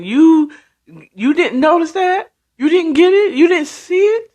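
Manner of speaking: high, rising taunting questions; mock disbelief. Mom taunting Toy who didn't notice, mock-incredulous. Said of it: "the mom is just taunting".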